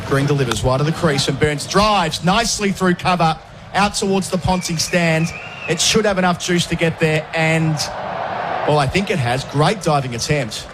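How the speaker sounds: lively play-by-play, pitch lifting as the ball runs; cricket commentator. Acted. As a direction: call the shot live as it races to the rope. Live ball-by-ball call.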